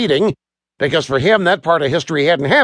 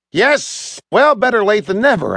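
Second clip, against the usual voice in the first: higher, lightly amused reply; cheerful, understated amusement; laughing reply; 7 semitones higher than usual. A narrator's reading. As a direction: say it with a touch of mirth. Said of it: "I said, laughing".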